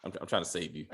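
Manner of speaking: hushed. Retold or imitated. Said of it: "They're like".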